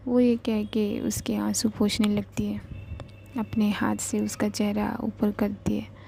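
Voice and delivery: ordinary delivery, nothing marked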